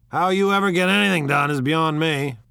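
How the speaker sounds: loud, high scornful jab; mock her laziness out loud. Tom to Jordan, scoffing loudly at her training.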